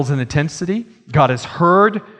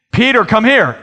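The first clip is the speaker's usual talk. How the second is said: louder, higher second call; parent calling louder; 7 semitones higher, 4 dB louder than usual. Pretend. Parent calling Peter a second time, sterner. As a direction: call the kid again with more edge.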